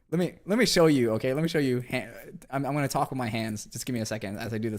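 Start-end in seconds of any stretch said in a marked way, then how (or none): 0.1-1.8 eager. Real, not acted. Insists on showing, raised voice.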